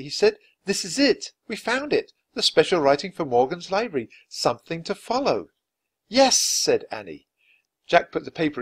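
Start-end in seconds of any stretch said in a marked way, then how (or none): 0.7-5.5 higher, eager realization; a touch of excitement at the discovery; mildly excited boy. A narrator's reading. 6.1-6.4 high, loud agreement; eager girlish yes; bright excited reply. A narrator's reading.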